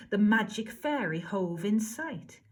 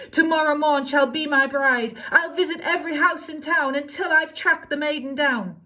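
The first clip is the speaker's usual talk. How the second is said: crying out; 6 semitones higher, 5 dB louder than usual. Acted. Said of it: "he cried".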